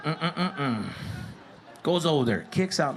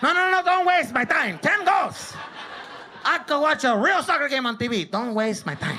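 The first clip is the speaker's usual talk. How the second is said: mock angry dad; 8 semitones higher than usual. Dad scolding, shouting.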